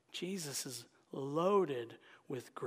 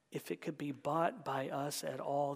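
ordinary talk

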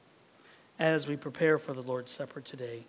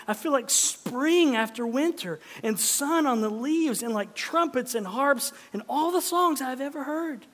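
higher, louder, bursting with joy; joyful cry; 9 semitones higher, 8 dB louder than usual. Acted. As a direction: let Sam's delight break out. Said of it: "he cried".

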